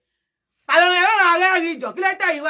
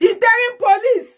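slow, higher proclaiming voice; mock announcement; 8 semitones higher, 4 dB louder, slower than usual. Mock-announcing the interim police. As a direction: announce it slowly like a title.

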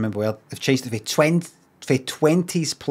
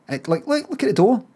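ordinary delivery

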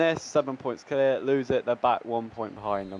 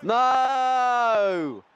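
long, high wail of dismay; anguished cry; 10 semitones higher than usual. Real, not acted. Gamer watching a goal conceded, anguished.

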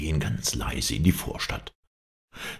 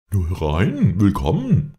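louder, higher calling-out; hearty welcome; 6 semitones higher, 5 dB louder than usual. A narrator's reading. Innkeeper inviting guests inside, hearty welcome. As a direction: call the guests in, voice lifting.

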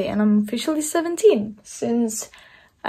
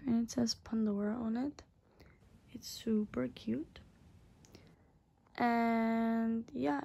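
whisper; 11 dB quieter than usual. Real, not acted. Whispering while showing the ring.

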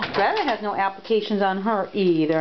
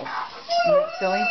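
very high, slow, drawn-out silly; slower than usual. Real, not acted. Owner amused by puppies lying on the shelf, high and slow. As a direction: stretch silly up high in delight.

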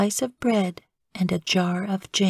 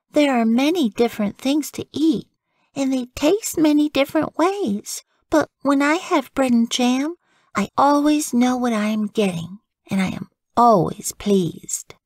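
put-on a higher childlike voice; little girl Frances; 6 semitones higher than usual. Frances explains to her parents, in a high child voice.

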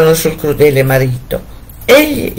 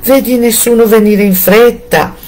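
calling out; 6 semitones higher than usual. A narrator's reading. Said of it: "le gridava".

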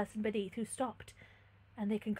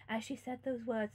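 plain reading voice

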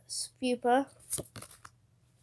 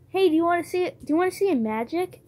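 put-on higher, louder, excited kid voice; eager school friend; 6 semitones higher, 5 dB louder, faster than usual. Retelling a school friend's magic trick, eager kid voice. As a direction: hype it like a kid showing a trick. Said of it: "my friend was like".

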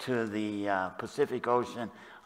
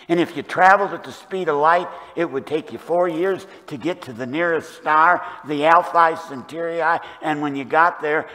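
loud, raised pulpit voice; full-force preaching; 5 semitones higher, 9 dB louder than usual. Real, not acted. Preacher telling congregation God is everywhere, full-force preaching. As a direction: drive it home higher and louder, phrase by phrase.

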